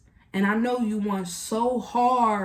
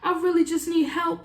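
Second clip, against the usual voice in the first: mock needy plea; 8 semitones higher than usual. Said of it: "they're coming to you telling you".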